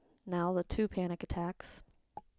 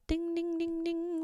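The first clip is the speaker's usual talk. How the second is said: alarm bell imitation; 10 semitones higher, slower than usual. Alarm bells of rising anxiety.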